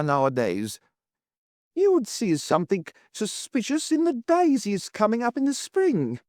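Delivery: high-pitched, scornful protest; agitated Mrs Worth, dismissive. A narrator's reading. Landlady calling Toby's suspicion ridiculous, high scorn.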